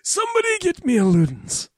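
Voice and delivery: very high rasping Dark Lord; Voldemort begging for a lozenge. Acted. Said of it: "The Voldemort voice".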